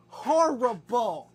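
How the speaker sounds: "Horrible" stretched out very slowly; drawn-out horrible. Real, not acted. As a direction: drag "Horrible" out slowly like a tease. Teasing the dog.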